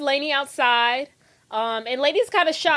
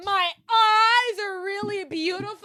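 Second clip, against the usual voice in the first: high, long-drawn melodramatic wail; mock outrage; 10 semitones higher, slower than usual. Host mocks a girl reacting to a compliment, melodramatic and high. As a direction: stretch it out high in hammed-up disbelief.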